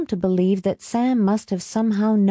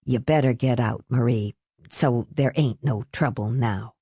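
low, subdued tired voice; tired man; 7 semitones lower than usual. A narrator's reading. Tired Renee urging Marie to leave, low and weary. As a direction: say it low and worn out.

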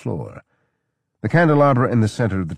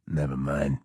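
quieter, lower mumble; softer, lower mumble; mumbled; 5 semitones lower, 6 dB quieter than usual. A narrator's reading. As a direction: mumble it away. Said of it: "he mumbled".

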